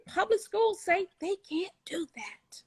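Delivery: unvoiced conspiratorial whisper. Real, not acted. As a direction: breathe it like a secret. Aside about public schools.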